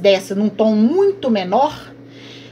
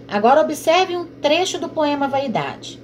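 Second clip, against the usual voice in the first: normal talking voice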